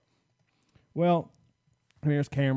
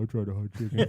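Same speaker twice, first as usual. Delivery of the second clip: Shaq voice; 6 semitones lower than usual. Retold or imitated. Imitating Shaq's voice.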